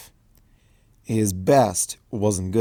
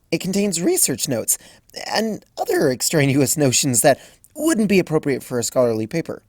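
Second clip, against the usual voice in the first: put-on a lightly raised, eager young mare; Twilight voiced; 6 semitones higher than usual. A narrator's reading. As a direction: read Twilight a touch higher, briskly. Twilight's voice in story.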